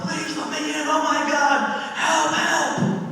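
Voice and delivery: high desperate plea for help; panicked pleading. Retold or imitated. Pounding on his door after seeing a ghost, high panicked plea. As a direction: beg frantically to be let in. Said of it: "and I said".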